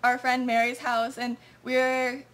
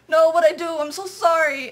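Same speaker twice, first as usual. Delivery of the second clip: much louder, pleading "no, what'd I do"; panicked apology; 3 semitones higher, 8 dB louder than usual. Retold or imitated. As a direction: driver begging the cop, scared. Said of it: "And Evan was like".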